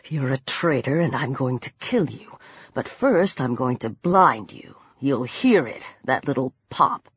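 very low, soft threat; quiet menace. A narrator's reading. Peter threatens the pinned traitor Lang, in quiet menace. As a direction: threaten him in a deep hush.

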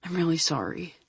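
soft, hushed apology; whisper. A narrator's reading. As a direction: barely whisper it. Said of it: "I whisper".

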